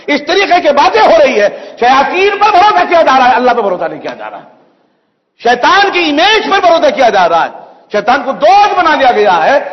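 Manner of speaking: preacher raising his voice against fear of Satan; fiery preaching. Acted. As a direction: thunder the rebuke at the congregation. Preaching at full force.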